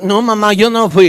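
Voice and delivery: a hint of a whiny child lying to mom; child's denial. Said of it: "Como el niño que".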